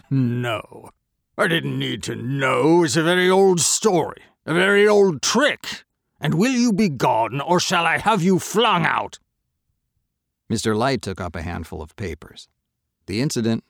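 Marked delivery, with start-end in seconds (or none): 0.1-9.2 much higher and louder, mocking contempt; scornful merchant. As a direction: throw the boy out with scorn. Merchant dismisses the boy.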